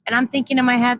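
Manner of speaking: ordinary talk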